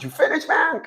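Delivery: quiet, high-pitched mock query; unimpressed interruption. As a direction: softly check if the rant is over. Retold bored interruption of rant.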